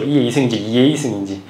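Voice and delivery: ordinary talk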